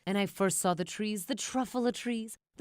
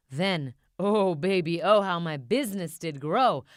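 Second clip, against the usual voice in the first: gloating delight; 6 dB louder than usual. A narrator's reading. Gloating about booming business.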